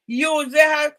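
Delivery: ordinary talk, nothing marked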